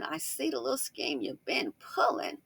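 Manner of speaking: knowing accusation. Acted. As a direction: tease out that you have caught them. Repeats accusation slower.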